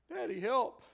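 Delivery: high loud plea; crying out for help. Retold or imitated. Sinners crying out to God for help. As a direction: call up like a child needing daddy. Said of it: "We're crying out".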